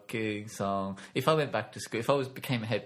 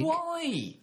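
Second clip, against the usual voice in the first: incredulous why. Real, not acted.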